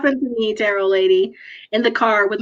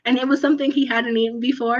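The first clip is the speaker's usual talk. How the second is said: ordinary delivery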